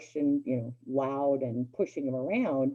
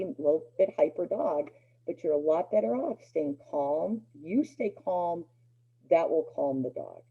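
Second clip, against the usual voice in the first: usual speaking voice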